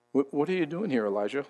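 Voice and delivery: soft, low and gentle question; delicate voice of God. Acted. Preacher voices God asking Elijah at the cave, delicate and soft. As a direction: drop low and soft, as if barely there. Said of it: "it was a delicate whispering".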